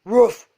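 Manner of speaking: sharp loud ruff; loud dog bark. Acted. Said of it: "said Hercules Morse".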